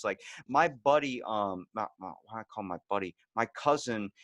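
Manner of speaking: ordinary delivery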